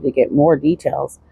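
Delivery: normal talking voice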